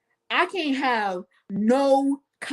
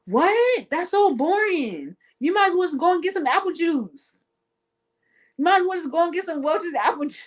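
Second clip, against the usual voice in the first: mock disbelief; 8 semitones higher than usual. Mock outraged reaction, much higher.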